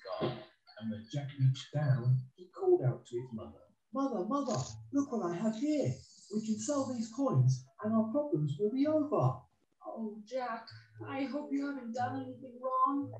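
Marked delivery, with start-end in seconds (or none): 3.9-9.4 put-on high eager boy calling his mother; Jack, excited. Jack shows his mother the coins, excited. 9.9-13.1 high, soft, anxious mother; worried mother. Acted. Mother fears Jack did something wrong, worried.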